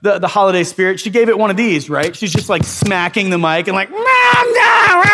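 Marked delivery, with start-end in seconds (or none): 3.9-5.0 put-on high, loud toddler protest; toddler yelling. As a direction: yell it like a one-year-old reaching for mom. Toddler hitting the mic, yelling.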